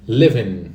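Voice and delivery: "Living" ending like IN; model pronunciation. Shown as a demonstration. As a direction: drop the G at the end of "Living". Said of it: "como se fosse I N no final".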